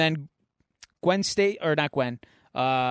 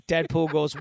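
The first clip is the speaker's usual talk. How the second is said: usual speaking voice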